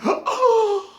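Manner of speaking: very high, slow falsetto gasp; high mock-shocked squeal. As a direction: act scandalised in falsetto. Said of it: "And I was like".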